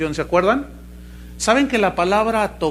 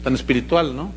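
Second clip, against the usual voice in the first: mock pious sarcasm; 6 semitones lower, 4 dB quieter than usual. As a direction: sneer at how spiritual they are. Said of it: "ustedes se ríen porque dicen".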